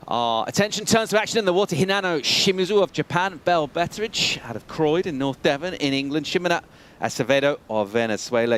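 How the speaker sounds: lively announcer reading the lineup; energetic contest announcer; announcer intro. Acted. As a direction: call out the surfers like a host.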